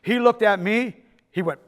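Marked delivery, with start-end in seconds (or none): none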